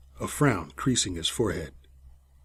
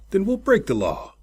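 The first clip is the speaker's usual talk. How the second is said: snapped; 10 semitones higher than usual. A narrator's reading. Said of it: "the captain snapped".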